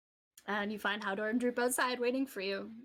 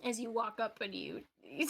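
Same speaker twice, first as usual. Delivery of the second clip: ordinary talk, nothing marked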